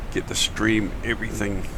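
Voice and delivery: usual speaking voice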